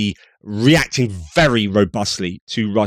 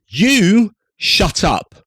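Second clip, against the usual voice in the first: mock retort; 8 semitones higher, much slower than usual. Mocking retort at official.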